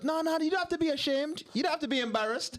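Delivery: lightly voiced Jamaican auntie consoling the cashier; imitated Jamaican accent. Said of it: "She goes".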